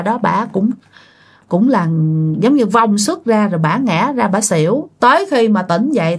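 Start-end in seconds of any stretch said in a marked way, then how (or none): none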